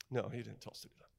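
quiet, dead serious. Real, not acted. Quiet correction after sarcasm.

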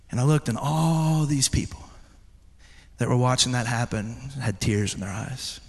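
ordinary talk

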